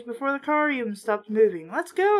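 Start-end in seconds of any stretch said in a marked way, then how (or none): 1.7-2.2 eager cry. A narrator's reading. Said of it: "I cried".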